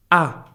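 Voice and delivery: ah starting high, falling steeply; short ah dropping from the top; sharp fourth tone. Shown as a demonstration. Teacher recapping the tones, ah sharply falling. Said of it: "is very sharp".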